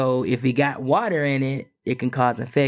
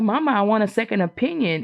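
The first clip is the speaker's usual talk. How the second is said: pleading to mom; 8 semitones higher, faster than usual. Retold or imitated. Retold pleading to her mom.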